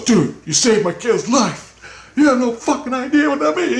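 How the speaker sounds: high, choked-up tearful gratitude; emotional father. Retold or imitated. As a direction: voice a father near tears. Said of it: "He's crying, he's freaking out".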